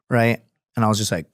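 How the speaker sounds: ordinary talk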